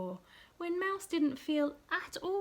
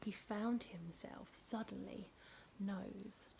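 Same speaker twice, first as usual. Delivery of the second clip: suspenseful whisper; 3 semitones lower, 7 dB quieter than usual. A narrator's reading.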